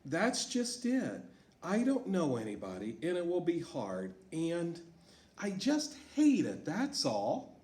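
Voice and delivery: put-on girl refusing the new school, upset. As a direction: whine it out as a kid who hates change. Teacher voices Sarah in story.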